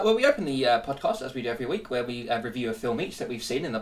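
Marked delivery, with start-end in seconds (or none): none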